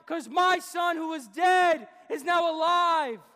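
loud, exultant father ordering a feast; big, joyous preacher's shout; jubilant proclamation. Retold or imitated. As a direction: call out the celebration to the whole house. Father joyfully ordering the celebration feast.

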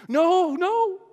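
frantic high Gollum; panicked creature. Acted. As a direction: squeal no in panic. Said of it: "Gollum panicking".

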